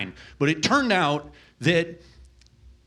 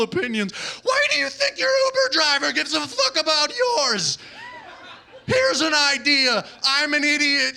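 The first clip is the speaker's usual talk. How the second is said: high-pitched exasperated yelling tirade; screaming rant; 8 semitones higher than usual. Acted. Uber driver losing it at an opinionated passenger, screaming rant. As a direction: blow up at the passenger, pitch shooting up. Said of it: "I can scream pretty much whatever I want".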